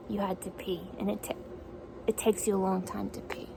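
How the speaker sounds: ordinary talk, nothing marked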